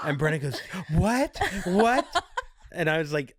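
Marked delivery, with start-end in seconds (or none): 0.9-2.1 high, rising mock-shocked "what"; sharply rising disbelief, acted out; incredulous reaction. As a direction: imitate someone taken aback, pitching it up. Retells Brenna's startled reaction.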